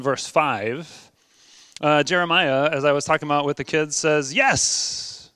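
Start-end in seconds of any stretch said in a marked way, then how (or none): 4.3-5.3 high-pitched, emphatic "Yes"; seruan antusias. Acted. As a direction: leap up in pitch on an eager yes. Jeremiah's eager yes, as told to kids.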